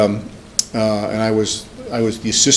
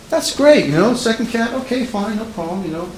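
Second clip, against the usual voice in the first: high-pitched, acted reassurance; agreeable husband; 9 semitones higher than usual. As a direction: pitch it way up, playing easygoing acceptance. Acting husband accepting second cat.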